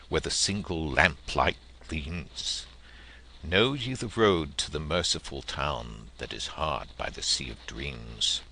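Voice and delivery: ordinary delivery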